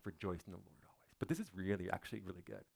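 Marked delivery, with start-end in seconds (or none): 0.1-1.2 muttered, much softer throwaway repeat; sarcastic quiet repeat; dismissive echo. Retold or imitated. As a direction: toss it off as a mock groan. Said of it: "Paul, you have no idea".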